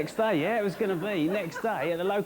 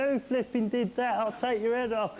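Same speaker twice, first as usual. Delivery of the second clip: mock angry jellyfish; 5 semitones higher than usual. Jellyfish angry when hit.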